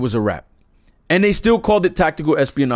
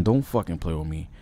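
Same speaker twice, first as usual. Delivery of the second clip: low pleading mutter; 8 dB quieter than usual. Real, not acted. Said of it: "I'm not in the mood".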